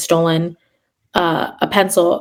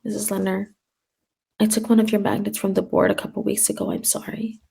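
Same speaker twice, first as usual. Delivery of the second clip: put-on a tiny, much softer, timid child voice; anxious child's confession; 8 dB quieter than usual. The anxious girl owning up to the teacher, timid and tiny. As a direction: confess in a small, scared voice. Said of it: "she'll be like".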